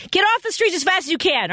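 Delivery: high-pitched motherly nagging; mock-maternal urging; playful mom voice. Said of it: "like your mom".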